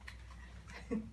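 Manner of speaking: short breathy villain laugh; a gloating wolf's laugh; wicked laugh. Acted. Wolf gloating over his trick, wicked laugh. Wolf after threatening girl.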